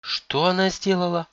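high, slow question of shock; shaken, incredulous daughter; stunned. A narrator's reading. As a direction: ask again, unable to believe it. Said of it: "потрясённо переспрашивает у мамы Сунок".

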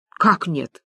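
understated, falling disbelief; puzzled question. Acted. Friends all ask Люба about the bank, puzzled. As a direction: ask it low-key, taken aback. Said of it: "хором спросили подруги".

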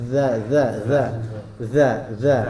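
"Za" as a th-like sound, tongue tip slightly out; dzal sound. Shown as a demonstration. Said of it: "lidahnya itu menonjol sedikit saja". ("za" dengan ujung lidah sedikit menonjol di antara gigi)

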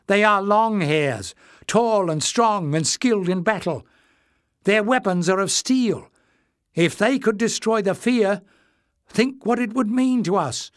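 high, loud, rousing plea; urgent appeal. A narrator's reading. Crowd member urging the others to let them try, high and rousing.

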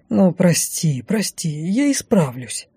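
slow, apologetic mumbling; guilty mumble. A narrator's reading. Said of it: "виновато бормотал скрипач". (виновато бормоча)

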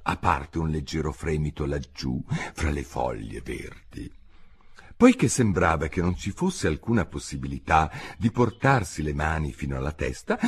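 usual speaking voice

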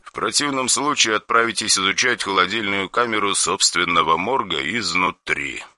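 soft, flat cold order; emotionless. Acted. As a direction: state it without any feeling. Caller voice.

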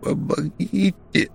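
high, slow rasping plea; wheezed plea. A narrator's reading. Suffocating Grek begs, slow high rasping plea. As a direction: wheeze it out, barely breathing. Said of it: "прохрипел он".

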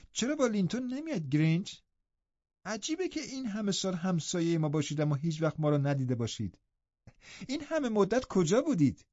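put-on high, cheerful young girl, softly projected; eager questions in a lifted, lively pitch; happy. A narrator's reading. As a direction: chat happily in a high girlish voice. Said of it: "با خوشحالی گفت".

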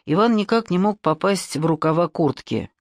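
usual speaking voice

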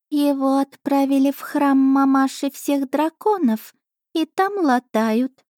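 high, slow scornful cat voice; snorting cat familiar. A narrator's reading. Cat familiar reporting on duke, high scornful voice. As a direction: sniff it off like a proud cat. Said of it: "фыркнула она".

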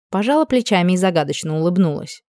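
plain reading voice

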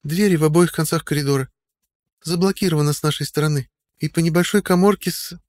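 put-on high young woman reporting; girl voice. A narrator's reading. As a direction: report it in a girl's voice. Audrey voiced by narrator.

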